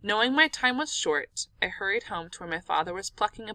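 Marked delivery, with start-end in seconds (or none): none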